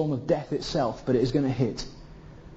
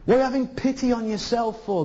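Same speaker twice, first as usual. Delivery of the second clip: ordinary delivery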